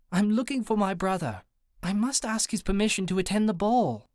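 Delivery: high, formal British lady voice; British accent. A narrator's reading. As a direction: speak high and properly British. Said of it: "without dropping the accent".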